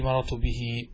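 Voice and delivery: مررت به with a long ee on "bihii"; ya ending. Shown as a demonstration. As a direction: lengthen the last vowel of به. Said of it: "والياء في".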